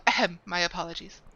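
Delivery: normal talking voice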